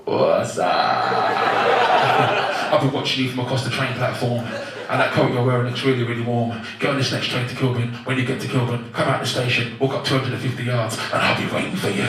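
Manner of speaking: low, loud smooth chat-up voice; creepy pickup line. Acted. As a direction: lay on the slimy charm on the platform. Chatting up a girl, stalker.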